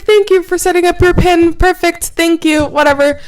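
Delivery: high, loud imitation of an automated thank-you; mock phone-line confirmation. Phone line confirms her new PIN, mocking imitation.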